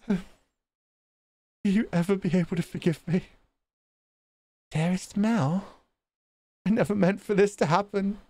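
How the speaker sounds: lightly voiced sobbing plea; tearful apology. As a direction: beg for forgiveness, voice thin with guilt.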